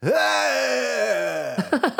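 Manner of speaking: high cry of falling. A narrator's reading. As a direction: cry out as you drop away. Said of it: "he cries as he disappears".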